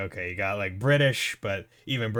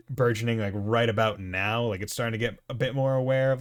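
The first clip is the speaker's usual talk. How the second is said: usual speaking voice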